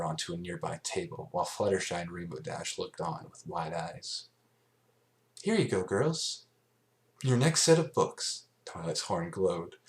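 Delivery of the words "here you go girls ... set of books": cheerful Twilight. Acted. Voices Twilight.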